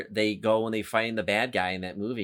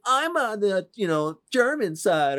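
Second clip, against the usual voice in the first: put-on much higher, slowed playful kid voice; mock kid gamer; 10 semitones higher, 4 dB louder than usual. Imitating kids playing war games, high mock kid voice. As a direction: squeak it like a kid playing Call of Duty.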